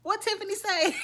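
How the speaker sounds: high, loud startled question; double take. Retold or imitated. Podcast host retelling her reaction to Tiffany's line, startled double take. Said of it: "I did a double take".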